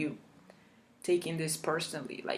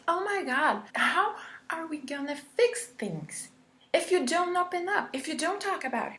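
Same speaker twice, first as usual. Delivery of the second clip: much higher, pleading and fast; mock frantic therapist; 9 semitones higher than usual. Imitating the therapist demanding she open up, high and frantic. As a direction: squeal it like a panicking counsellor begging. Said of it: "That was his tone of voice".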